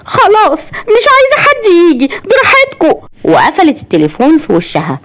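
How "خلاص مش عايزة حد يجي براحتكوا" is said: very high acted upset voice; زعل وعياط. A narrator's reading. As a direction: whine it tearfully, pitched way up. Upset cousin hangs up. (صوت عالي جدًا زعلان بيعيط)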